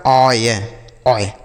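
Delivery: ऑय as a low oy glide, said alone; OY read as ऑय. Shown as a demonstration. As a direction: model ऑय clearly for learners, pitched low. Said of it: "ऐसे पढ़ेंगे". (ऑय का साफ़ उच्चारण, जैसे oy)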